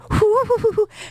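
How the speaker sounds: high, airy "hoo-hoo" like a recorder; imitated recorder toot. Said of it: "[name] with a recorder".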